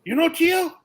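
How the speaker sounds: can't believe what he's hearing; amazed question. Retold or imitated. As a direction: Irad quizzes the rider about his uncle in surprise. Irad asking, amazed.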